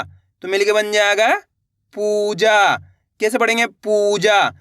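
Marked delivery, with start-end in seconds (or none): 1.9-2.8 पूजा with a long held ऊ; stretched vowels. Shown as a demonstration. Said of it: "इसी तरीके से खींच के पढ़ना है". 3.7-4.5 पूजा with a long held ऊ; stretched vowels. Shown as a demonstration. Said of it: "इसी तरीके से खींच के पढ़ना है".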